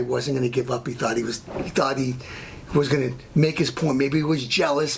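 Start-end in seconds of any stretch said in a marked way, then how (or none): none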